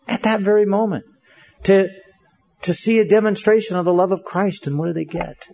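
ordinary delivery

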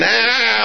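high-pitched mock scream; drawn-out high yell, acted. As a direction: imitate panicked men screaming. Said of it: "The guys were screaming".

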